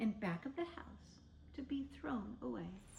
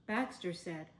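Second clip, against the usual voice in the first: usual speaking voice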